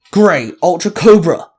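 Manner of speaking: loud battle call. A narrator's reading. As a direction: shout the morph call. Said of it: "the Grey Ultra Ranger called out".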